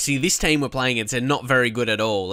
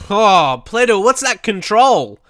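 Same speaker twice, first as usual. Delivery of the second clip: exasperated outburst; 7 semitones higher, 6 dB louder, slower than usual. Real, not acted. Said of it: "I'm close to losing the plot".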